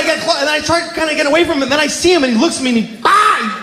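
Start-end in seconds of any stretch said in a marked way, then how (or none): 3.1-3.6 high mock scream of panic; sudden high "ah"; panicked deer cry. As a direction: imitate a startled animal's yelp.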